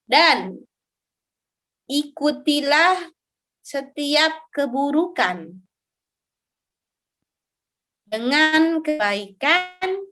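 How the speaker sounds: slow, measured phrases with long pauses; hadith teaching recitation. Real, not acted. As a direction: recite and translate slowly, phrase by phrase. Recites and translates hadith slowly.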